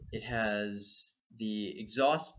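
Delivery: usual speaking voice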